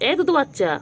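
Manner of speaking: high cooing pet talk. Real, not acted. To her cat, high cooing baby talk. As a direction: coo it up high to a kitten.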